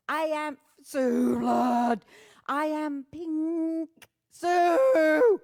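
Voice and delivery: loud, high, stretched call of her name; playful shout. Pretend. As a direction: belt it out to a friend. Calling out friend's name.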